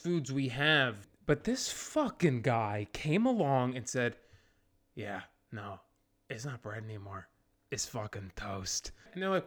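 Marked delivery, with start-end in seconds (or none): 4.9-8.9 low, hushed, deadpan announcement; quietly matter-of-fact, pitched low; low-key inventor. Acted. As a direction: drop right down, very soft and low. Inventor calmly announces toast.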